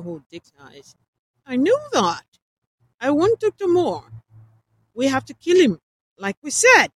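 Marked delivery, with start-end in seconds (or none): 1.4-6.9 high, loud insisting; insistent outburst. Acted. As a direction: dig in and insist loudly. Nathan insists loudly.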